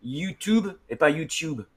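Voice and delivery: YouTube said Yootube first, then Tyootube; slow, put-on accent YouTube; American vs British YouTube. Shown as a demonstration. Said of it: "ils disent par exemple".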